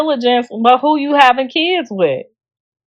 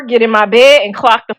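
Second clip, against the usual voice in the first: ordinary talk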